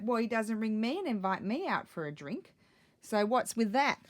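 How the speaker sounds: teasing mock complaint, loud. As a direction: tease him with mock outrage. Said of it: "I was just teasing him".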